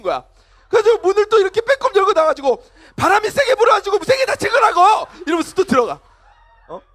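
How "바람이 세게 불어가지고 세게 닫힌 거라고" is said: high, loud defensive protest; hammed-up teenager yelling an excuse; shouted excuse. Acted teen's excuse after slamming door.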